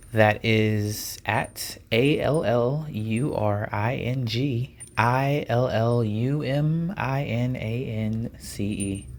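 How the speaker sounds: spelled letter by letter, slowly; slow deliberate spelling. Shown as a demonstration. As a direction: lay out each letter slowly like a chant. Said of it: "Oh, that was so beautiful".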